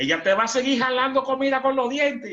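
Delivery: high, loud sermon bellow; a comic preacher booming at the couple; mock preaching. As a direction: belt it out to the wedding crowd. Preaching at wedding, crowd laughing.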